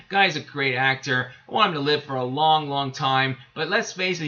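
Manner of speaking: ordinary talk, nothing marked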